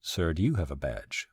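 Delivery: mock military-police officer, low and restrained; quietly official, like a hint of an MP; lightly put-on police voice. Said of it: "in my best M P voice say".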